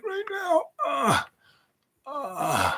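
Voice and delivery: soft voice sinking into pained moans; aching groan. Real, not acted. Speaker feeling as sore as mother, soft pained groaning. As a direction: let it dissolve into weary high groans. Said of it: "I'm kinda feeling that way".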